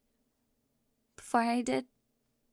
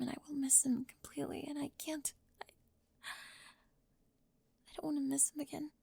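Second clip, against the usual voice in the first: softer, barely voiced, building fast at the end; tearful whisper; 5 dB quieter than usual. Spring pleading with Raven in a tearful whisper. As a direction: spill it out through tears.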